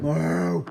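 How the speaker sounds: a loud cow moo; loud mock moo. Cow sound again.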